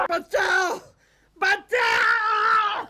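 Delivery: very high, long wailing shout; screaming for help. Acted. Man with his neck about to be twisted, screaming for help. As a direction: wail for rescue on long vowels. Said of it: "चिल्ला रहा हूँ".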